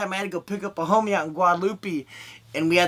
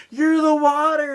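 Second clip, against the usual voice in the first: high, loud dreamy tripping voice; tripping comedian; 8 semitones higher, 5 dB louder than usual. Retold or imitated. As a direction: sound high on shrooms. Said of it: "I'm gonna be like".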